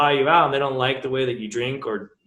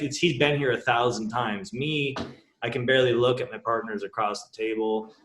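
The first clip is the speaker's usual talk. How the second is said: ordinary talk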